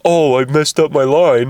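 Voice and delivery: hammed-up oops; mock flustered actor. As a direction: act out fumbling a line and owning up. Acts out an actor flubbing.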